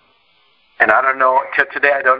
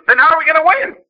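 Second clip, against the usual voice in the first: eager dad; 4 dB louder than usual. Retold or imitated. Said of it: "He goes".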